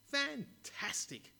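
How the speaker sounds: very high, loud, drawn-out Fantastic; exclaiming. Acted. The mayor thrilled by the boy's answer, exclaiming. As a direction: burst out with delight. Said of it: "exclaimed the mayor".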